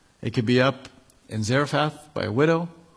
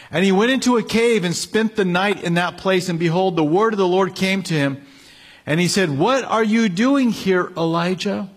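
forceful scripture reading; 5 semitones higher than usual. Acted. Preacher reading Elijah's story to the congregation, proclaiming. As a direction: preach the verse out to the room.

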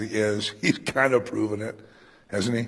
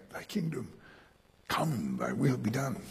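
whispered prayer; 9 dB quieter than usual. Acted. Quoting the Lord's Prayer.

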